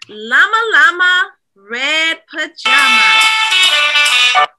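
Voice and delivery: slow, drawn-out title call; title announcement. Acted. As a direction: stretch the title out grandly.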